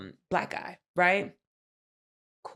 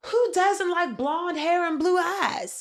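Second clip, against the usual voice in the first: much higher, slow mocking imitation; mock entitled contestant; 11 semitones higher, 4 dB louder, slower than usual. Friend mocking a contestant's vain question, much higher. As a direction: ham up her smug vanity. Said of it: "she was like".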